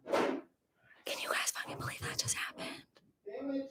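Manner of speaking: slow whispered aside to viewers; secretive slow whisper. Real, not acted. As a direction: hush it to the camera. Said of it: "whispering aside to viewers".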